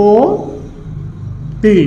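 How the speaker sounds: অতি split very slowly into long "o", then "ti"; correct pronunciation. Shown as a demonstration. Teacher answering what the pronunciation will be, অতি drawn out as "o... ti". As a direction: stretch অতি as "o... ti" with a closed o. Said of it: "উচ্চারণ হবে কি?".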